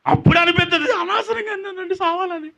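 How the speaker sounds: high, acted wail of regret; mock tearful regret. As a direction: whine it high as if crying, for show. Mimics regret after wishing death.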